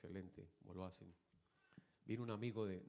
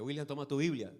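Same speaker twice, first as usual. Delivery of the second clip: loud, raised-pitch command; imitated fatherly order; 5 semitones higher, 12 dB louder than usual. Father handing William his Bible, imitated loud order. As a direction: call it out like a parent giving orders.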